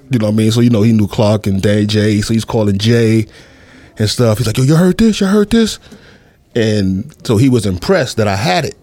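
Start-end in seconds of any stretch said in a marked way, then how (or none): 4.6-5.8 high-pitched, eager questions; excited questioning. Retold or imitated. Man excitedly quizzing a friend about a record, high and eager. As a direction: act out an excited friend checking, pitch jumping up. Retelling Dame's excited phone call.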